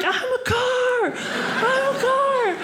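very high, slow, stretched-out cry; exultant cry. Retold or imitated. Girl in parking spot joins the crowd's chant, exultant cry. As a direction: proclaim it to the crowd, drawing it out.

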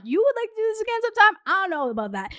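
high, fast disbelieving question; incredulous echo. Retold or imitated. Echoing date's offer of another date, incredulous. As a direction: throw it back sharply in disbelief.

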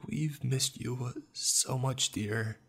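high, slow, drawn-out sorrow; pained, sorrowful mom. A narrator's reading. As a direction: ache with longing as a grieving mother. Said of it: "My mom's voice spoke, dripping with pain and sorrow".

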